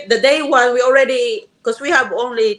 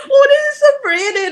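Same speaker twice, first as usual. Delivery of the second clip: very high, crying squeal of admiration; mock tearful delight. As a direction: re-enact being moved to tears, pitched way up. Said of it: "Then I cried".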